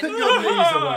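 laughing tease. Real, not acted. Teasing the smug winner.

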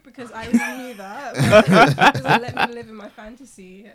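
loud laughing through the words; laughing loudly. Real, not acted. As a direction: laugh hard while explaining. Laughing about her own crush.

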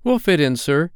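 put-on high, eager boyish promise; assuring boys. A narrator's reading. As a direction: assure the gentleman as keen youngsters. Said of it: "they assured him".

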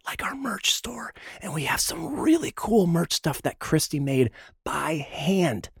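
hushed, slow secret whisper. Acted. Whispered merch plug.

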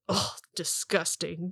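slow, softly voiced disgust; disgusted elf. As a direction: draw it out in quiet distaste. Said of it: "The elven man is like".

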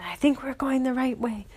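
eager hushed whisper; thrilled but keeping it secret; excited whisper. Acted. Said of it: "they were so excited".

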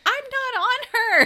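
very high, loud, drawn-out mock protest; yelled protest. Acting out John answering Ellie in bed, yelled protest. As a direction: wail it in hammed-up disbelief.

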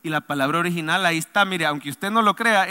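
usual speaking voice

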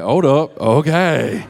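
mock double take. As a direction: play a single person suddenly perking up. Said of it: "Some of y'all are like".

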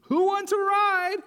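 high, loud squeaky invitation; very high character voice; little blue truck. A narrator's reading. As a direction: call out as a small eager truck. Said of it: "said Blue".

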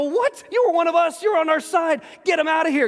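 mock angry mob shouting him down; outraged crowd. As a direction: cry out in betrayed outrage.

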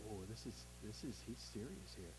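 hushed, low, halting realization; a little shaken up. Acted. Preacher imagining John's reaction at the cross, a little shaken up. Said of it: "slightly just a little shaken up".

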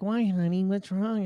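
high-pitched imitation of a worried mother; retold motherly concern; concerned mom. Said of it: "So I call my mom".

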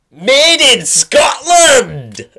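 high, loud, drawn-out mock Scottish cry; bad Scottish accent. Impressionist announcing a programme title, hammed-up Scottish shout. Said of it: "with a bad Scottish accent".

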